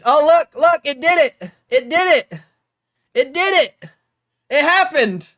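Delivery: high loud thrilled; excited. Real, not acted. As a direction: blurt it out in disbelief. Trade hits target live.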